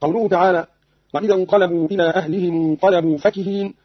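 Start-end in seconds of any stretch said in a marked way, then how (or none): none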